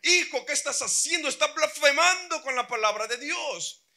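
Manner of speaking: furious father. Retold or imitated. Father scolding his son over the Bible, furious. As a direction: snap at the boy for disrespect. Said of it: "el papá se pone furioso y le dice".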